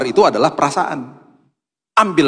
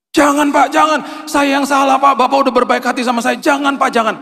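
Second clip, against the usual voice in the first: high, loud begging shout; pleading refusal; 12 semitones higher, 3 dB louder than usual. Retold or imitated. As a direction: cry out no, no, refusing the gift. Driver refuses money, pleading.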